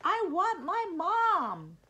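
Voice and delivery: high, loud, drawn-out wail; child's wail. A narrator's reading. Eddie calls for his mom from the basket, wailing. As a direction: cry out slowly like a scared little kid.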